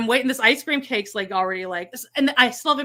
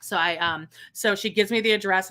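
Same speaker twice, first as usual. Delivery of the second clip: usual speaking voice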